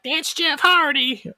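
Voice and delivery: high, drawn-out imitation of an onlooker; mock exclamation. Jamie Noble points out who it is, high drawn-out mock exclamation. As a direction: stretch it out in a high put-on voice. Retold shout of recognition.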